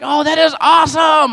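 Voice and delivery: high, loud delighted exclamation; gushing parent praise. Retold or imitated. A parent praises a child's drawing, loud gushing delight. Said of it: "we're like".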